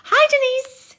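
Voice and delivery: squeals a viewer's name; delighted hello to a fan; high delighted greeting. Real, not acted.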